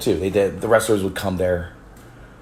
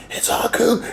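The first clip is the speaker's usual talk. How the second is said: excited call; 13 semitones higher than usual. Retold or imitated. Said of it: "They're like".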